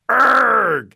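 loud, high "urgh" of loathing; disgusted groan. A narrator's reading. Boy hears they will learn poetry, disgusted groan.

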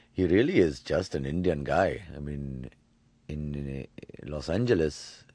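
a light Indian accent; Apu voice. Shown as a demonstration. Actor explains Apu while slipping into a light Indian accent. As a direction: ease into Apu's Indian accent. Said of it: "how did you come up with the voice of Apu".

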